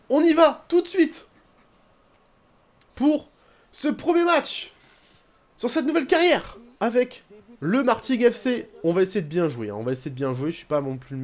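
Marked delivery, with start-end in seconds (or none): 0.1-8.7 high, stretched announcer hype; hyped match intro. Real, not acted. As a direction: kick off the match like a TV host. Launching the first match, hyped.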